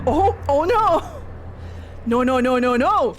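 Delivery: a hint of panicked goddess; hammed-up protesting; mock alarm. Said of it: "Mythal was like".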